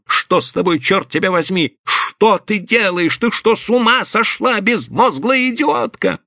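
high, mounting exasperated fury; outraged berating. A narrator's reading. Husband scolding his wife who is choking Rita, outraged. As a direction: berate her, pitch climbing to the end.

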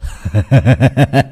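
put-on drunken unhinged laugh; cackling taunt; mad laughter. A narrator's reading. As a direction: laugh in your father's face. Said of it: "cười lên sằng sặc".

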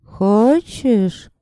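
high, very slow, drawn-out female voice; otherworldly woman's voice. A narrator's reading. Unseen woman offers a dying man survival, otherworldly female voice. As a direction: draw each word out high, like an eerie temptress. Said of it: "спросил женский голос".

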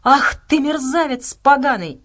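loud, high angry outburst; outraged insult. A narrator's reading. Acted insult at the killer.

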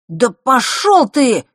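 high, loud, drawn-out retort; angry dismissal. A narrator's reading. Boy insulted by a rival, angry dismissal. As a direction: tell him off loudly and slowly. Boy snaps back in quarrel.

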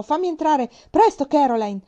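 loud, high urgent shout. Acted. Father shouting at the door for his wife to open. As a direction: yell it through the door to be let in. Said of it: "papà urlò".

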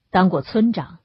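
usual speaking voice

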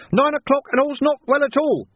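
high-pitched town-crier alarm call; shouting. A narrator's reading. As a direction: cry the alarm out high and urgent. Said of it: "ringing his bell madly and shouting".

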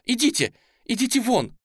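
высокий гневный крик; выгонять криком, высоко и громко. A narrator's reading. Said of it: "закричала она". (пронзительно прогонять наглеца)

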